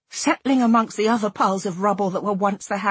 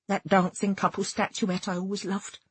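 plain reading voice

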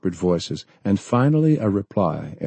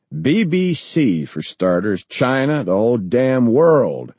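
defiant; 5 semitones higher, 5 dB louder, slower than usual. Acted. Defiant demand for broadcast.

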